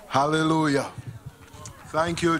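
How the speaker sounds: ordinary talk, nothing marked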